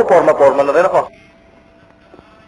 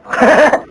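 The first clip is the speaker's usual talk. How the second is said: ticklish laugh; 8 semitones higher, 3 dB louder than usual. Acted. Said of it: "Kiliti ako dyan eh".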